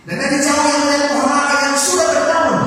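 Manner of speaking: loud, high, ringing Bible reading; preacher reads the fig tree verse to the congregation, loud and high; fervent scripture reading. Acted. Reading scripture with rising fervor.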